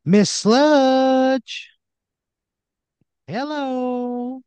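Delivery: very high, drawn-out taunting holler; Randy Wrench. Acted. Randy hails Miss Sludge from below, sing-song yell. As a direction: hold each call long on a high note. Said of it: "He, he yells out".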